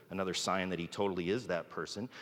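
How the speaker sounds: ordinary talk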